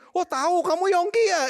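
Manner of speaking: put-on light up in recognition as the old woman; canteen lady. Canteen lady recognizes Yongki, high and surprised.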